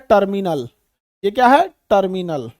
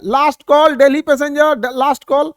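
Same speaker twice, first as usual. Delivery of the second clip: very loud, high last call; urgent last call; 4 semitones higher, 7 dB louder than usual. Retold or imitated. Staff yelling the last call for Delhi passengers, very loud. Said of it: "जब final".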